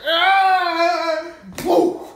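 very high, loud mock shout of a stoned guy; hammed-up drunk friend hollering before hitting a tree; imitated yell. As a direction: belt out a wild high yelp. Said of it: "he's just like".

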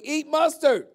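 high, loud mock yell; playful yelled chant; retold yelling. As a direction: shout it like the crowd egging her on. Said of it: "people just started yelling".

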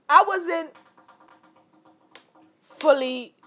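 usual speaking voice

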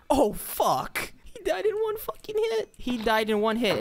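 high squeal of disbelief; shocked outburst. Real, not acted. Gamer stunned by a one-hit kill, high shocked squeal. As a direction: shriek it, caught off guard.

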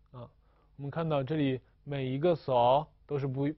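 ordinary delivery

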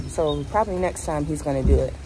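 usual speaking voice

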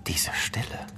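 hushed terrified whisper; fearful whisper. Acted. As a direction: whisper it, frozen with dread. Alone at midnight facing the rat.

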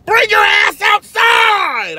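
high, loud, slow shouted command; mock cop yelling at the house; cop bellowing. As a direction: bark the order like a cop. Cop ordering him out.

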